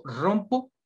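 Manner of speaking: rompo with a strong rolled r at the start; trilled R demo. Shown as a demonstration. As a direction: roll the r of rompo hard. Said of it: "es una forma de pronunciar la R". (rompo con la erre fuerte y vibrante)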